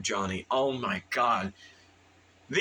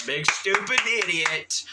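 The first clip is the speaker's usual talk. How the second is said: self-mockery; 7 semitones higher, 4 dB louder than usual. Mocks self for clapping.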